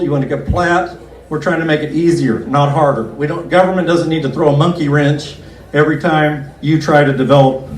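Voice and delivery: ordinary talk, nothing marked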